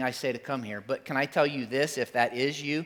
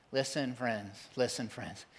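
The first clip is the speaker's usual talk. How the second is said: quieter, intimate call to listen; soft appeal; 2 semitones lower, 9 dB quieter, slower than usual. Real, not acted. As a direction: draw them in softly. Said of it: "repeated soft appeal".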